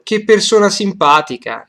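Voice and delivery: "Che persona simpatica" loud and high, hitting "Che" and "simpatica"; stress on CHE, SIMPATICA. Shown as a demonstration. Teacher showing phrase accent, loud emphasis on "Che" and "simpatica". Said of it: "che, simpatica is underlined".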